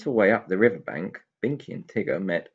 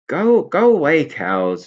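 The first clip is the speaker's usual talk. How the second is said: much higher, louder shout at cows; shooing; 7 semitones higher, 11 dB louder, slower than usual. Acted. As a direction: drive the cows off. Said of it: "said Binky".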